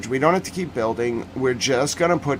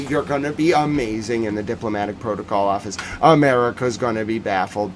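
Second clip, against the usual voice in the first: ordinary talk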